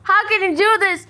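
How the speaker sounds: high, loud, anguished protest; outraged yell. Real, not acted. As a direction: yell it at the one who hurt the kittens. Outrage at the cat killing.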